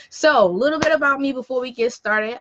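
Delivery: normal talking voice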